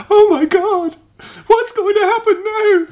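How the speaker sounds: high, loud mock panic of an overhyped fan; mock fan hysteria. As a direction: ham up a breathless fanboy gasping at a plot twist. Mocking hyped fans online.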